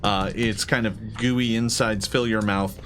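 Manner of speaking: ordinary talk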